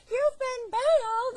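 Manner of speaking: high, drawn-out mock scream; imitating girl yelling. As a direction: yell it slowly, piercingly high, imitating an excited girl. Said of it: "some girl starts yelling".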